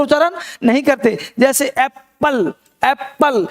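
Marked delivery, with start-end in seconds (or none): 1.7-3.5 एप्पल as "ap-pal", both p's sounded; wrong, geminated एप्पल; doubled consonant. Shown as a demonstration. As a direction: split एप्पल slowly on a double p. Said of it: "एप्पल बोलना गलत होगा".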